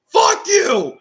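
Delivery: high, loud shouted parody; Kyle Busch impression. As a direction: bark it as the cocky driver. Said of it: "he starts off with".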